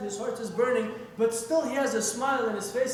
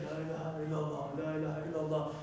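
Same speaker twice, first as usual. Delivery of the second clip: low, soft murmured devotion; hushed, lower-pitched repeated prayer; quiet recitation; 6 semitones lower, 7 dB quieter, faster than usual. Retold or imitated. Man reciting quietly in his room.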